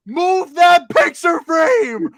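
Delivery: high, loud, drawn-out mock yell; imitated yelled order. Storyteller imitates woman ordering him at the bins, yelling. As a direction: holler it slowly like a demanding stranger.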